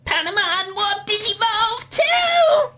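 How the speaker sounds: very high drawn-out cartoon battle cry; Patamon. Shown as a demonstration. Co-creator shows how the character should sound, very high squeaky voice. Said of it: "wasn't quite Patamon's voice".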